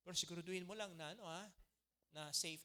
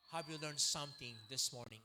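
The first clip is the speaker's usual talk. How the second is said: normal talking voice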